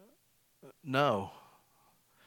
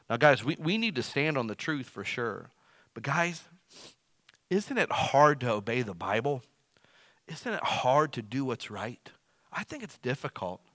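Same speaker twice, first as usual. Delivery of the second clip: ordinary talk, nothing marked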